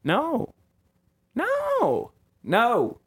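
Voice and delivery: very high squeaked no; mock-panicked squeaks of denial; squeaky denial. Frantic denial of bounties.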